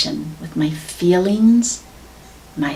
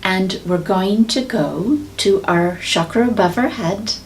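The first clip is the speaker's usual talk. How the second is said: guided meditation. Acted. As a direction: guide them gently into visualization. Leads a meditation.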